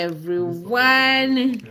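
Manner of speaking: plain reading voice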